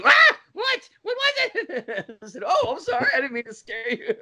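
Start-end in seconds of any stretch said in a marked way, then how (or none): 0.0-2.2 high-pitched startled shriek, then a laughing wail; hammed-up panic, drawn out at the end; freaking out. Startled driver demands to know what happened, mock panic. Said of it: "she freaked the fuck out". 2.5-4.1 high, squeaky apology through laughter; laughing apology. Retold or imitated. Passenger apologizes for scaring the driver, helplessly laughing.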